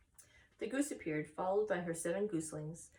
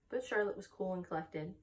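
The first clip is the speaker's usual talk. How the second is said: plain reading voice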